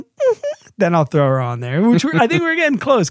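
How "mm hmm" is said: very high, squeaky little hum; tiny toddler yes. Retold or imitated. Mom imitating her daughter agreeing to Instagram, very high squeak. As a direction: squeak a yes like a three-year-old. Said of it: "She's like".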